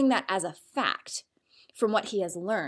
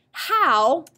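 "How" punched, higher and louder; "How" lifted up and loud; stressed; 5 semitones higher, 5 dB louder than usual. Shown as a demonstration. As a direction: hit "How" hard. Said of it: "How is a question word".

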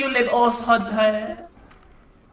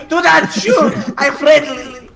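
panicked shout; 4 semitones higher, 7 dB louder, slower than usual. Acted. Panicked in-game plea.

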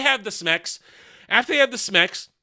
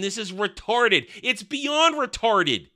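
ordinary talk, nothing marked

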